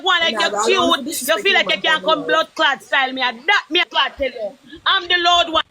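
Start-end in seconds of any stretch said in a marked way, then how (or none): none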